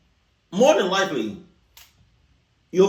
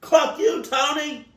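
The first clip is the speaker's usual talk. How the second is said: mock angry shout; 10 semitones higher, slower than usual. Cursing out the stranger named Tony, high and shouted. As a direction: spit it at him.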